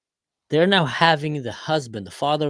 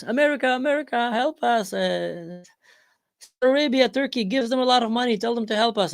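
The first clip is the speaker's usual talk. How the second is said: much higher hammed-up begging; begging Syria; 10 semitones higher than usual. Syria begging America and allies, much higher pleading whine. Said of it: "Syria comes".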